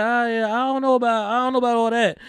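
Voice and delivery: high put-on voice, hedging; doubtful reply impression. Voicing a wary reply to intruder.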